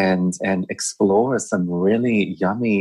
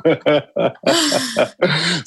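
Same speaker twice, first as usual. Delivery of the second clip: laughing; 7 semitones higher, slower than usual. Real, not acted. Laughing agreement about juice joke.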